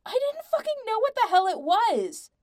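high-pitched, full-volume defensive yell; shouting back. A narrator's reading. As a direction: shout straight back at the accuser. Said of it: "Patrick shouted right back".

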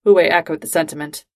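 ordinary delivery, nothing marked

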